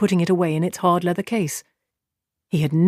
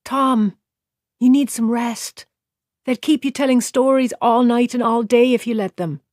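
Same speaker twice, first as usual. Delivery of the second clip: put-on higher, gently coaxing a tired drunk; gentle; 6 semitones higher than usual. A narrator's reading. Serving girl to drunken Tom, gently higher. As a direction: ease him toward bed, tenderly. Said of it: "her voice gentle again".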